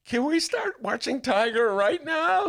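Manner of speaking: desperate plea. Retold or imitated. After the New York Times articles, begging loudly and slowly to watch Tiger. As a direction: plead like a kid who can't wait. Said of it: "I was like".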